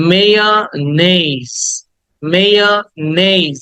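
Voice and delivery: মেয়োনিজ as slow may-uh-NAYZ; correct pronunciation. Shown as a demonstration. Teacher models the word for students, slow careful pronunciation. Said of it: "উচ্চারণ করো".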